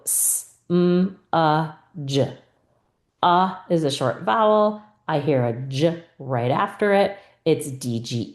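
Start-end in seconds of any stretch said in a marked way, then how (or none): 0.0-2.4 "s, m, a, j" as separate speech sounds, with gaps; sounding out. Shown as a demonstration. Teacher sounds out smudge for a game, "s, m, a, j" one by one. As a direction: say each sound of "s, m, a, j" alone. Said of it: "You might have to go".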